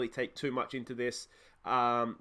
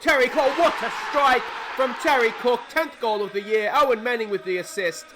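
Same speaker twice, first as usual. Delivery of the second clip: loud, high shout of a football commentator; shouted, elated goal commentary; excited goal call; 8 semitones higher, 9 dB louder than usual. Real, not acted. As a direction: erupt as the striker scores, then start easing down. Commentating a goal just scored.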